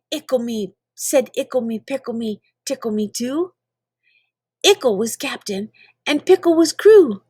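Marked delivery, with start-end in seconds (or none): none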